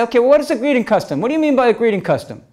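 quizzing students. Retold or imitated. Students quizzing teacher, mocking.

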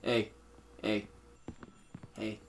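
whispered secret; whispering. Pretend. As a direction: whisper it softly. Whispered aside.

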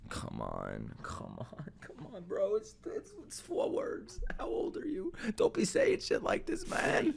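whispered urging under breath; quiet tense whisper at the screen; hushed whisper. Real, not acted. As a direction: keep it barely voiced and tense, pleading. Tense scary scene, hushed urging.

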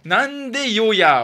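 Okinawan phrase. Pretend. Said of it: "は言うさ、沖縄は".

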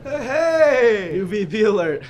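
shout. Acted. Shouting to stop talk.

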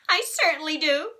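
a hint of a bratty little girl complaining; tattling girl Sheila. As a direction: tell on him to the grown-up. Sheila insists.

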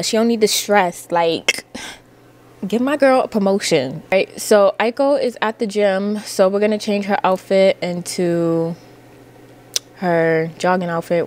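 ordinary talk, nothing marked

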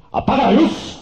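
high, loud yell; shouted order. Acted. Guy yelling at the woman to turn off the light. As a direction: bellow the command. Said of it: "le grita el güey".

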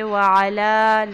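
وعلی very slow, lam held long; correct recitation. Shown as a demonstration. Teacher demonstrating وعلی. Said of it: "لام کو لمبا کیا جائے گا".

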